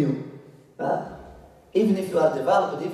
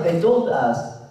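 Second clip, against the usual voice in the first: ordinary talk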